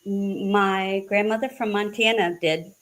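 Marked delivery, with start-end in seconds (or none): none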